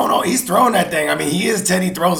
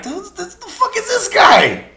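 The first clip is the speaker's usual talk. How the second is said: much higher, strained disbelief; incredulous outburst; 13 semitones higher than usual. Retold or imitated. Reacting to an unknown receiver scoring, a high, incredulous outburst. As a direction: throw the question up high in disbelief. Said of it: "and I'm like".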